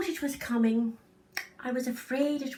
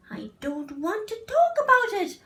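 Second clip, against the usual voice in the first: higher, louder insistent refusal; upset refusal; 10 semitones higher, 6 dB louder than usual. Acted. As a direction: dig in and refuse, loudly. Child refuses again, upset.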